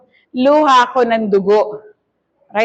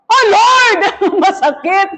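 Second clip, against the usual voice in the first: high cry to God, half laughing; mock pained cry; 6 semitones higher than usual. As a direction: act out crying out under the knife. Reenacting surgery without anesthesia.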